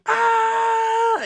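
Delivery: piercing high mock shriek; comic imitation of Banshee's sonic scream; super scream. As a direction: belt out a sudden superhero scream. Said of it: "He does a super scream".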